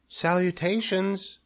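high, slow tiny greeting; small voice. A narrator's reading. Baby spider greets Wilbur, small high voice. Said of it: "he heard a small voice".

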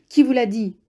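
high, loud exclamation of alarm; startled cry. A narrator's reading. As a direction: cry the question out sharply. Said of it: "s'écria le rentier".

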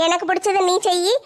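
ordinary talk, nothing marked

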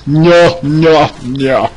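high, loud cartoonish protest; mock protest. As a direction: cry out in a put-on voice. Playful cry ending dark character bit.

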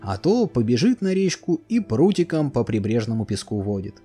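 ordinary delivery, nothing marked

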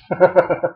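brief chuckle of disbelief; amused laugh. Real, not acted. Resident reading that his correspondence became a formal complaint, amused laugh. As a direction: laugh shortly at the absurdity.

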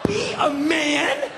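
high, drawn-out disbelieving question; incredulous echo. Retold or imitated. Homeowner repeating burglar's challenge, incredulous.

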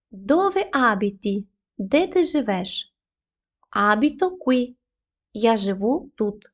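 ordinary delivery

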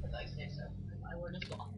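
whispering. Acted. Mock authority aside.

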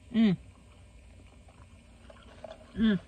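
while eating a bite; muffled by food; mouth full, chewing. Real, not acted. Seen 2: Hand brings food to mouth, then jaw chews visibly.

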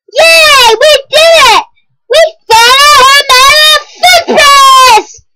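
high, loud triumphant shout; mock cheer. Ending the Dora book with a hammed-up victory yell. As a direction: cheer like a kids' show finale.

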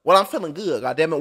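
usual speaking voice

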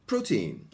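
put-on "Protein" as PRO-teen, strong PRO and falling "teen"; native pronunciation model. Shown as a demonstration. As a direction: give "Protein" clearly for students to repeat. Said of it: "私のネイティブの発音を真似してください".

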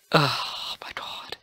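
hushed whisper. Real, not acted. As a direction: breathe it out softly, slowly. Quiet reaction in game.

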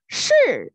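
是 high and loud, falling sharply; emphatic shi demo. Shown as a demonstration. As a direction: model a crisp, falling 是 for the pupils. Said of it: "And then say, 是".

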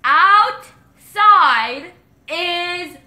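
emphatic, widely spaced words; slowed down. Real, not acted. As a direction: stretch each word for someone who can't hear. Slowing phrase for lip-reading partner.

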